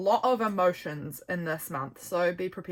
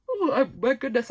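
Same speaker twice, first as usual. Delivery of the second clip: yawning; 5 semitones higher, 5 dB louder than usual. Real, not acted. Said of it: "I'm yawning so much".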